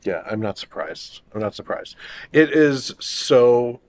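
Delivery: usual speaking voice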